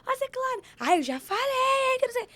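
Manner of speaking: high, loud, exaggerated imitation of an expansive woman; expansive family woman. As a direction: belt it out like a loud, extroverted aunt. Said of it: "Todas as mulheres da minha família são muito expansivas". (imitando uma mulher expansiva e escandalosa, bem alto e agudo)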